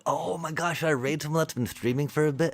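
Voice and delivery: high, quick, excited rush; bubbling over with excitement. Real, not acted. Excited, confused by raid.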